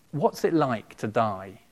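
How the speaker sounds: normal talking voice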